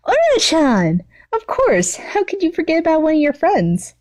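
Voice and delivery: put-on high, sing-song girly voice; a sweet, high obsessive schoolgirl; gushing villain girl. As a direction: play the clingy yandere girl, all sugar. Toga voiced in read story.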